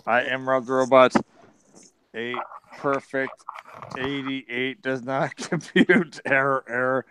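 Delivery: monotone robot voice. Acted. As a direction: recite it evenly like a machine. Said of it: "what has Robbie the Robot sound?".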